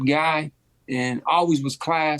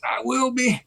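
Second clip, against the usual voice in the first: strained pained voice; 9 semitones higher than usual. Retold or imitated. Hurt, hiding it from opponent.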